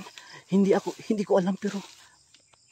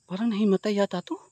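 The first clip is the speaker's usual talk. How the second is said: usual speaking voice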